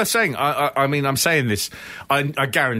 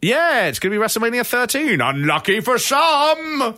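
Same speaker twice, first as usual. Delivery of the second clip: hyped announcement; 7 semitones higher than usual. Real, not acted. Co-host teases next week's episode, high excited hype. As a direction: build to a high, stretched-out finish.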